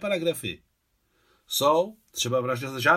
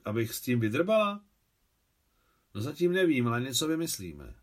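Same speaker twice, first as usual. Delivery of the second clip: ordinary delivery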